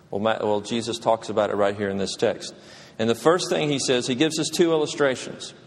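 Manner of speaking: ordinary talk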